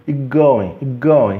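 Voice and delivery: "it going" as "ig going"; T omitido. Shown as a demonstration. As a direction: swallow the T into the G of "going". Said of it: "praticamente não pronuncio aquele T". ("ig going", sem o T)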